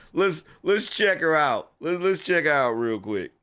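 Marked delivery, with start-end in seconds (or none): none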